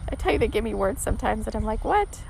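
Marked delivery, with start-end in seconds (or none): 1.8-2.2 sudden high squeak of confusion; a startled, high-pitched what; baffled what. Retold or imitated. Host hears a strange word from her guides, high baffled yelp.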